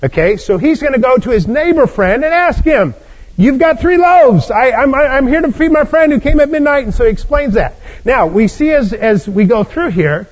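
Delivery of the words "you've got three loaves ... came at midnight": pleading host. Acted. Host asks neighbor at midnight.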